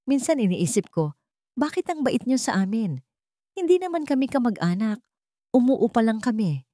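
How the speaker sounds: lightly voiced Liwayway, curious; young woman's voice. A narrator's reading. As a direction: voice the tenant, wondering aloud.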